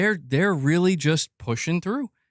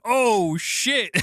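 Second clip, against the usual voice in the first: high, startled oh shit; hammed-up corporate alarm; mock panic; 9 semitones higher than usual. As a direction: pretend to be caught out. Imagining Sony panicking.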